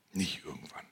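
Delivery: quiet whisper, driving it home; whispered warning. Acted. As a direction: breathe it out low and firm. Whispered punchline.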